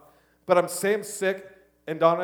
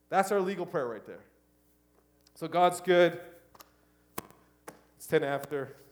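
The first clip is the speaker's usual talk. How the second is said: normal talking voice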